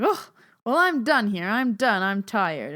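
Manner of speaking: high, loud grandma declaring she's done; hammed-up old lady giving up; grandma loudly announcing she is tired; dramatic grandma.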